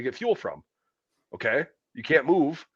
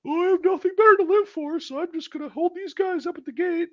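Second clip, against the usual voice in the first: very high, whiny mock of the gate guard. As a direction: sneer at the guard in a silly high voice.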